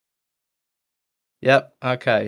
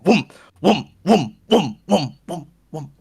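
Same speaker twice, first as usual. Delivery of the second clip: repeated high, loud whooms dying away; swooping, falling whooms in steady rhythm; imitated coffin noise; 5 semitones higher, 5 dB louder, slower than usual. Tyler hears the coffin behind him, repeated whooming. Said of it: "He hears it".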